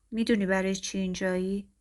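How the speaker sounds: plain reading voice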